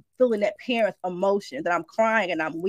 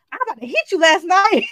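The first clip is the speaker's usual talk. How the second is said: loud, high, playful threat; laughing tease; 8 semitones higher, 7 dB louder, faster than usual. Retold or imitated. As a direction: tease him half-laughing. Telling husband the dream, laughing.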